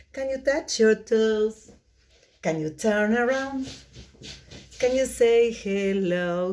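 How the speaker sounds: put-on fast singsong kids' song; sped-up singsong puppet show host. Shown as a demonstration. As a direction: sing it again, very quickly. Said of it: "very, very quickly".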